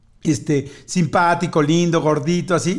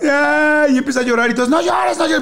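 high mock wail and hushing; 7 semitones higher than usual. Little cousin starts crying.

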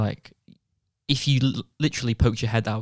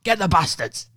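higher, eager imitation of a battle cry; mock rally cry; 7 semitones higher, 3 dB quieter than usual. Friend imitates paintball women egging on the team. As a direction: egg the team on like a rowdy forties woman. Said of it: "they were kinda like".

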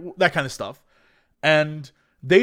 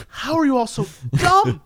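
higher-pitched comic disbelief; mock exasperation; 7 semitones higher than usual. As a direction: retell it in baffled exasperation. Retold exasperated reaction to show.

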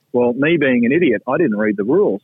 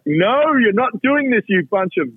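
high, slow, drawn-out protest at the screen; hamming up a yell at the television; mock yell at the TV; 9 semitones higher, slower than usual. Retired golfer at home yelling at the TV, high and slow. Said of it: "You might even hear me yell through the T V".

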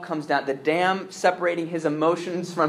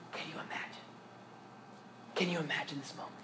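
hushed whisper; 17 dB quieter, faster than usual. Real, not acted. Preacher hushes at emotional climax.

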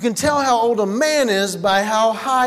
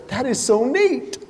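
1 mock enthusiasm; 3 semitones higher, slower than usual. Shown as a demonstration. Wife's fake-enthusiastic line.

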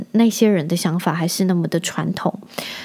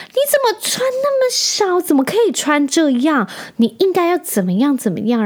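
much higher, put-on lecturing voice; mocking a scolding old man; 9 semitones higher than usual. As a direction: parody the stranger nagging her. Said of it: "然后他就说". (学阿伯高声说教，夸张嘲讽)

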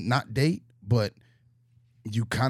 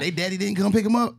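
mocking eager boyfriend; 10 semitones higher, 7 dB louder than usual.

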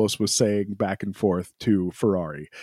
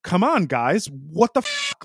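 higher, pressed, complaining radio rant; frustrated Charles rant; 5 semitones higher than usual. Retold or imitated. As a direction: imitate an angry driver demanding to know why. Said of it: "that was the tone".

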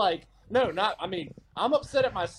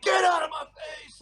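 mock shout; 9 semitones higher than usual. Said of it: "it's like".

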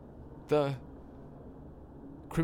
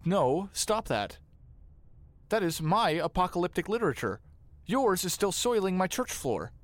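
higher, indignant objection; indignant protest; 7 semitones higher than usual. Acted. Priest objecting as the thief takes his scroll, indignant. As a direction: protest in a raised pitch, scandalized.

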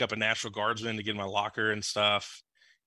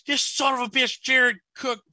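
furious yell; 13 semitones higher, 6 dB louder than usual. Retold or imitated. Saints fan yells at the radio after a bad play, high furious shout. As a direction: bellow it at the radio like the play just cost you. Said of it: "I go".

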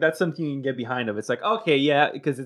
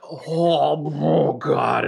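acted, louder groaning "oh God"; mock agony as the liquor hits; drawn-out, hammed-up reaction; burning-drink reaction; 3 semitones higher, 5 dB louder, much slower than usual.

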